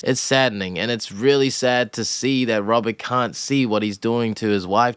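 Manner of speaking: usual speaking voice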